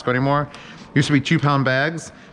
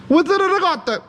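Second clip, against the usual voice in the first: much higher, hammed-up Italian accent; a parody Italian caricature, pitched way up; mock Italian accent. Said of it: "making fun of what Italians sound like".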